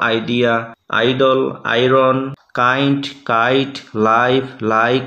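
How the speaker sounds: slow, long eye vowel in আইডল, আয়রন. Shown as a demonstration. Teacher to students, slow demo of the eye sound. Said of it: "প্রথম sound হলো আই sound".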